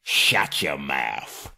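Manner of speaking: a hint of a choking troll William; mild gruff troll retort; choked troll snap. A narrator's reading. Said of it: "he said, as soon as he could".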